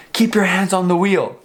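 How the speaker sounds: mild mock yell, pitched high; retold scream. Cop ordering driver to freeze, mild retold scream. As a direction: imitate a cop's scream without really shouting. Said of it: "he screams".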